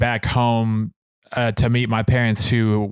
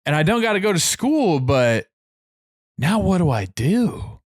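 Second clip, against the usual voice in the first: higher, plaintive mock-lost kid; a little sad; 5 semitones higher than usual. Kid on first day of summer, mildly sad and lost. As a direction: stretch the words in mild, aimless dismay. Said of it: "you're kinda sad a little bit".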